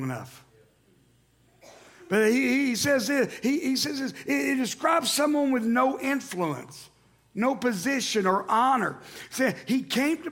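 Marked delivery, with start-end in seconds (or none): none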